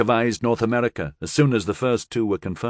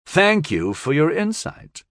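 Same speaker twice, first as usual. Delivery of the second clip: caustic; 6 semitones higher, slower than usual. Acted. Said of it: "North America said caustically".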